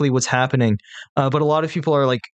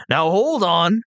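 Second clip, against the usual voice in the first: higher, menacing bandit hobgoblin; a sly threat from a hobgoblin; 6 semitones higher than usual. Acted. GM voices hobgoblin demanding robies.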